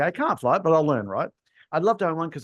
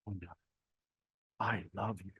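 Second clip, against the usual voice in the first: hushed, much softer, creepy "I love you"; 6 semitones lower, 13 dB quieter than usual. Shown as a demonstration. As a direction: breathe "I love you" close and suggestive. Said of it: "I'm gonna end up in a tribunal".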